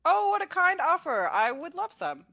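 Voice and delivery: high, effusive bard's thanks; gracious delight. Acted. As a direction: accept grandly, voice lifted high. Bard accepts goblin's offer.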